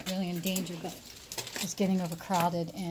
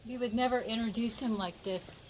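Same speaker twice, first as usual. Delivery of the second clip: ordinary talk, nothing marked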